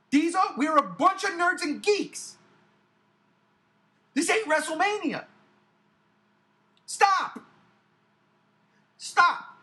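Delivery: high, forceful telling-off; exasperated scolding. Real, not acted. Fan telling the hype man to stop, exasperated and loud. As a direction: punch it out loud with long pauses.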